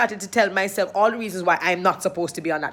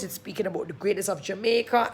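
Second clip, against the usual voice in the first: ordinary talk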